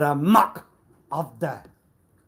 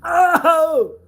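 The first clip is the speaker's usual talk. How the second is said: very high, loud yelp; hammed-up gasp of realisation; mock surprise; 13 dB louder than usual. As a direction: burst out in fake astonishment. Sudden exclamation.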